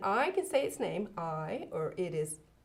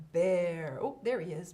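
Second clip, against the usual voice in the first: normal talking voice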